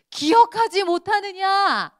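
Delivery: 크고 높게, 천천히 기억하지를 강조하며; 한 마디씩 힘주어 느리게 다그치기; 느린 꾸짖음. Retold or imitated. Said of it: "예수님이". (제자들을 꾸짖는 예수님, 크고 느리게)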